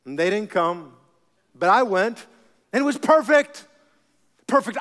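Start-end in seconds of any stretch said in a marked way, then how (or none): 2.7-4.8 thrilled. Real, not acted. Excited about church service.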